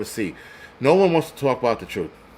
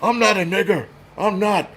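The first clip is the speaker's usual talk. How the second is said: higher, put-on indignant voice; mock indignant denial; 6 semitones higher than usual. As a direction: mimic someone insisting on who they are.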